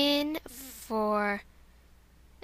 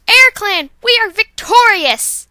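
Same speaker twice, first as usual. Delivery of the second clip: loud, high second take of the victory cry; same victory shout, a bit less slowed; 7 semitones higher, 13 dB louder, slower than usual. Acted. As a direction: shout the win to the whole clan.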